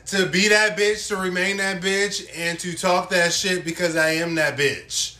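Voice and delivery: loud, high, bragging; hyped boast. Real, not acted. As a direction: shout it like a diva anthem. Brags then says kidding.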